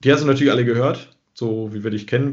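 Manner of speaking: ordinary delivery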